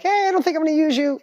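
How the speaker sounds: polite, hesitant letting go. Retold or imitated. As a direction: gently let her down. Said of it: "It was more like".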